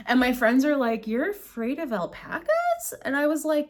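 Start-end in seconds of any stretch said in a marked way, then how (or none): 1.1-2.9 high, drawn-out disbelieving question; mock incredulous. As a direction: imitate a friend's incredulous question, stretched out. Friends' incredulous question, acted out.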